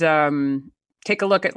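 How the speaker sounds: normal talking voice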